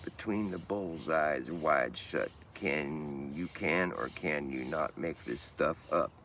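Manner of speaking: slow, measured spoken-word verse; poem recital. Acted. Reciting verse on the downward spiral, slow poetic delivery. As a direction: stretch each phrase out with deliberate weight.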